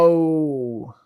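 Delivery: gasp of realisation. Real, not acted. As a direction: gasp as the memory suddenly lands. Suddenly remembers the episode.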